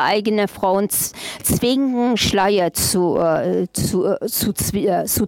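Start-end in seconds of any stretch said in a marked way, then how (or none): none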